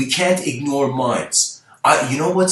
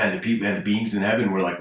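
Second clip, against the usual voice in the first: usual speaking voice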